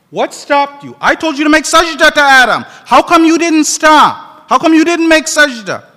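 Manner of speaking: loud, stern, demanding questions; commanding voice. A narrator's reading. Preacher voicing God questioning Shaitan, loud and stern. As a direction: demand an answer with authority. God's voice in Quran story.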